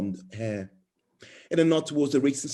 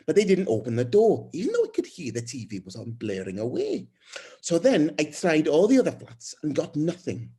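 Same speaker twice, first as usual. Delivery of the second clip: higher-pitched, rambling neighbour explaining herself; chatty new neighbour; 5 semitones higher than usual. Acted. Newcomer who knows nobody explains to her neighbour, chatty and higher-pitched. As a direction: chatter on in a raised, lively voice.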